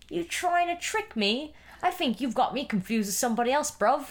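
British tough-guy voice, hammed up; British accent. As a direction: do a cheeky London accent. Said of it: "I sound like Idris Elba".

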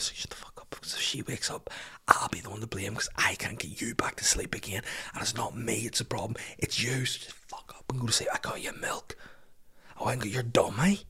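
tense, breathy whispered reasoning; whispered pleading. Retold or imitated. Dad bargaining with a baby who won't sleep, whispering. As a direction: plead in a whisper so nobody wakes.

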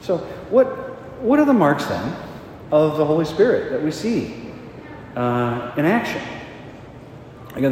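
full-force preaching, high and loud; fervent preaching. Acted. Priest urging his congregation to trust the Holy Spirit, fervent. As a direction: rouse the congregation to act.

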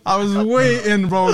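high and loud, laughing through the words; laughing excitedly. Real, not acted. Telling friends about the escape, laughing loudly. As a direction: burst out laughing with relief. Relief retelling escape from robbers.

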